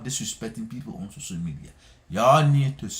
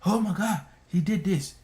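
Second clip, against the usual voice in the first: mock gossip gasp; 8 semitones higher than usual. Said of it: "You want people to say".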